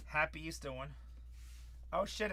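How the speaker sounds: ordinary talk, nothing marked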